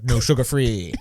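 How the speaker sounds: lightly put-on Dutch accent, playing a race driver; bad Verstappen accent. Mocking a Dutch racer to the co-host, bad Dutch accent. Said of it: "that was a terrible accent".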